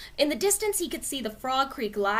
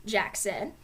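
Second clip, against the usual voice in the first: usual speaking voice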